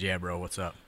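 a hint of a chill meathead; low bro voice. Matt Morgan answering the prank call, a low laid-back bro. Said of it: "He says".

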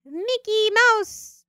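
very high, loud squeaky mouse; shrill little vampire mouse squeal. Pretend. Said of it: "Does anybody have a vampire mouse impre".

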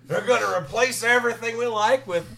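hammed-up wrestler rant; Dusty Rhodes impression. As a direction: cut a promo like Dusty Rhodes. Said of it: "your Dusty Rhodes was your best impression".

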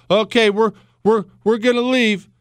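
high, stammering appeasement; hammed-up meek compliance; mock submissive Americans. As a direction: play a flustered official giving in. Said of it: "That's how we talk now".

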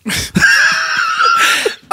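high shrieking burst of laughter; laughing shriek. Real, not acted. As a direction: squeal out a laugh.

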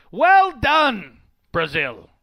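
loud, high mock "Well done"; mock British praise. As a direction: imitate a British football pundit. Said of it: "people don't say well done very often".

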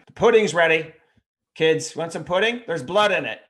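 high, loud mock mealtime call. Parent announcing blood pudding to the kids, mock calling out.

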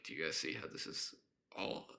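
hushed low whisper. Retold or imitated. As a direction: lean in and whisper it. Teacher's aside to class, retold.

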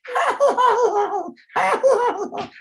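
giggly falsetto as if being tickled; tickled squeaky Batman. Retold or imitated. Mocking Batman tickled by Catwoman, squeaky giggling falsetto. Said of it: "He was like".

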